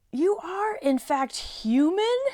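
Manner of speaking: high, slow, wondering faun; slow puzzled faun voice; slow fawn voice. Acted. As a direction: draw it out, amazed faun. Fawn dialogue.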